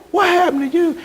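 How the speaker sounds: stunned what happened; shocked question. Retold or imitated. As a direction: blurt it out in disbelief. Shocked at aged old flame.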